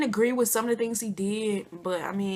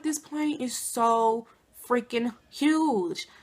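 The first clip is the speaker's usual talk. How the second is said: slow amazed drawl; much slower than usual. Retold or imitated. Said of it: "I'm just like".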